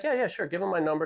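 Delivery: high, easygoing yeah sure; breezy friend. Retold or imitated. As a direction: toss off a casual yes. Friend agreeing casually on phone.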